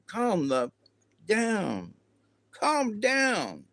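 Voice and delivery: high, exaggerated calm down; a sing-song telling-off; mock scolding. Telling mocked viewer to calm.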